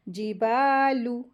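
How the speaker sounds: जिबालू with long held baa; stretched madd. Shown as a demonstration. Teacher repeats the madd, जिबालू slowly stretched. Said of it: "इस तरीके से".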